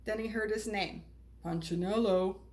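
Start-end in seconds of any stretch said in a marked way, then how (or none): 1.4-2.4 a lightly deepened maker's voice; deep and strong. Acted. Eli calls to Punchinello from the workshop, deep and strong. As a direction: call his name, calm and strong. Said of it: "the voice was deep and strong".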